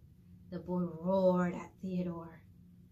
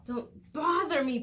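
bellowing; 6 semitones higher, 5 dB louder, slower than usual. Acted. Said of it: "he bellowed".

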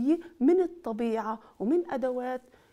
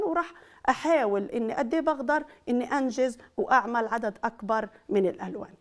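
ordinary talk

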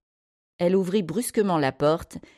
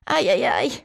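high-pitched cries of pain; high, pained yelping; agonised moan; 7 semitones higher than usual. A narrator's reading. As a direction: wail "ow" as if badly hurt. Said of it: "gémit d'un ton agonisant".

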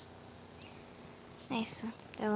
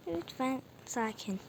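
normal talking voice

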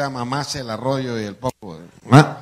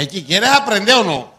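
higher, louder exasperated push; loud impatient challenge; 7 semitones higher, 7 dB louder than usual. Retold or imitated. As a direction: snap it at him impatiently. Impatient teacher voice.

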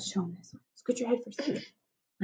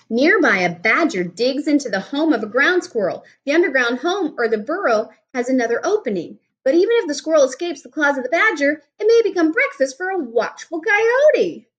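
put-on reading to children; 5 semitones higher than usual. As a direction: clear storybook reading voice. Reads picture book aloud.

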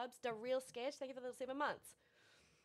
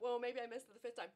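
ordinary talk

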